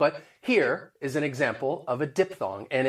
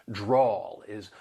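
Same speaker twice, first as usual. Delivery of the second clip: Southern drawl; 3 semitones lower than usual. Shown as a demonstration. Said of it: "That Southern drawl".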